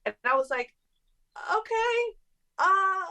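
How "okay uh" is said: high, hesitant, thrown off; awkward reaction. Retold or imitated. Reacting to a fan's odd whispered remark, high and awkward. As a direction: awkwardly go along, voice pitched way up. Acting her awkward reply to him.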